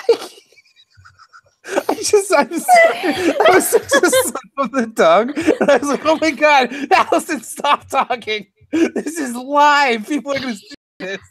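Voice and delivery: high, laughing through it; can barely talk for laughing; giggling apology. Real, not acted. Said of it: "I'm crying".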